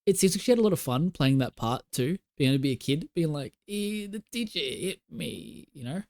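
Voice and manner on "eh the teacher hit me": put-on small whiny child voice; kid voice. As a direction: whine it like a little kid. Acting out a kid character.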